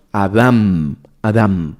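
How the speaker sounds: slow Adam, stressed on the second A; Hebrew pronunciation. Shown as a demonstration. Preacher putting the Hebrew word into the verse, slow Adam with final M. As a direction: say Adam deliberately, closing on M. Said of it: "con un acento en la segunda A y con M".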